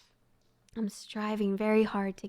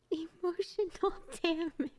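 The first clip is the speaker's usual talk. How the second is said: laughing; 8 semitones higher than usual. Real, not acted. Jokes about sad story.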